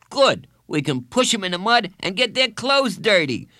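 high, loud schoolboy scheming; excited little bully voice; gleeful boy bully. A narrator's reading. Bully boy relishing his plan.

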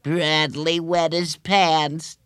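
high, slow sing-song taunt; drawn-out schoolgirl mockery; teasing. A narrator's reading. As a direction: tease him like a playground chant. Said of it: "teased Laurie".

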